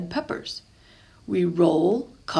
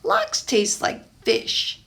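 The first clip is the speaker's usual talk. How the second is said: whispered, slow and higher, grossed out; disgusted; 10 semitones higher, 3 dB louder, slower than usual. Acted. Pablo telling Papa he dislikes lox, whispered disgust. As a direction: wrinkle your nose at the fish. I crinkle my nose.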